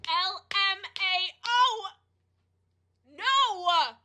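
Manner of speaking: high, slow outraged squeal; a high, stretched cry of "no way"; shrill disbelief. Real, not acted. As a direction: drag it out in shocked disbelief. Outraged reaction to stood up.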